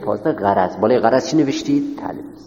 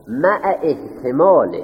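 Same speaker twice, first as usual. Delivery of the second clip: each word drawn out slowly for writing; slow dictation; 3 semitones higher, much slower than usual. Shown as a demonstration. Teacher dictating to students, slow and deliberate. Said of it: "بالای مع باید".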